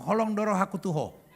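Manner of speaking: brisk, unsweetened love declaration; matter-of-fact Batak. Acted. Comic showing a Batak declaring love, brisk and matter-of-fact. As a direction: say "Holong doro haku tuho" briskly, no sweetening.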